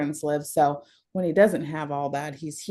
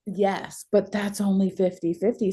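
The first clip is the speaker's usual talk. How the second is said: usual speaking voice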